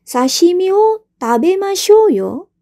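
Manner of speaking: high, loud, slow let's-eat call; a teacher acting out an eager let's-eat; eager invitation. Shown as a demonstration. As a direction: invite them brightly. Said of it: "kata kerja imasho".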